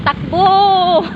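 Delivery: sudden loud call to run; shout. Real, not acted. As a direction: yell it out as you break into a run. Vlogger suddenly starts running, shouts. (malakas na sigaw)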